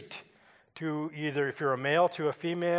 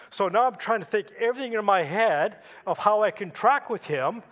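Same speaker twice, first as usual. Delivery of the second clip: ordinary talk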